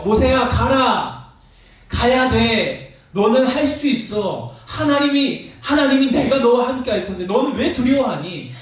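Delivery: 높은 음으로 느리게 힘주어 설득; 마음을 두드리듯 한마디씩 밀어붙이는 설득; 간곡한 설득. Acted. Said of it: "계속 그의 마음을 설득하고 있어요". (모세를 설득하는 하나님처럼 간곡하게)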